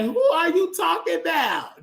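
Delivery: high, shouted mock confusion; baffled who. Online strangers not knowing the fallen star, shouting in high mock confusion. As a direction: toss it up high like a puzzled stranger asking who this guy is. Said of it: "they be like".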